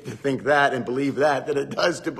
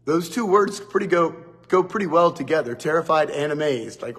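ordinary talk, nothing marked

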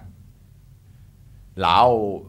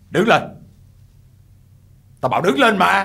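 stern order; 8 semitones higher, 8 dB louder than usual. Acted. Old man orders boy up.

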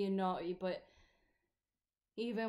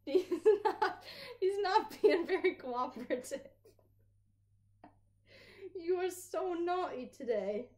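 higher, louder doggy talk with a laugh; pet talk; 9 semitones higher, 4 dB louder than usual. Real, not acted. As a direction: tease the naughty puppy fondly. Scolding the dog fondly.

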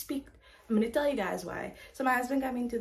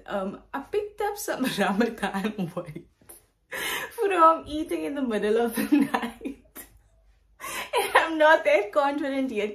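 laughing; 3 semitones higher, 5 dB louder than usual. Real, not acted. Laughing while confessing weight.

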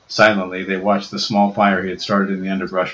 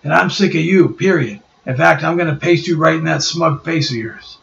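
higher, louder tough-guy threat; squaring up for a fight; menacing Ben; 8 semitones higher, 3 dB louder than usual. Acted. Ben threatening Reed, louder and menacing.

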